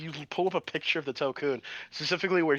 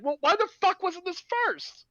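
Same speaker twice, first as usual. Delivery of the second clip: pitched way up, baffled; incredulous high outburst. Retold or imitated. Mocking the film's order, high baffled squeal. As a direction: squeal it in disbelief. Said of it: "And it's like".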